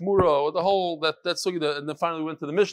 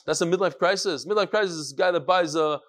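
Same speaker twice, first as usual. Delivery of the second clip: ordinary talk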